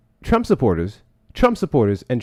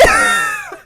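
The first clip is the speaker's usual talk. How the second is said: burst of laughter. Real, not acted. Host cracking up at a caller, shrieking laugh.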